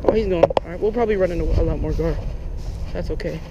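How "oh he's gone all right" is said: normal talking voice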